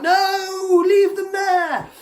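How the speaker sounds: high, slow parody of the mother; squeaky drawn-out refusal; high mock voice. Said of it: "she's like".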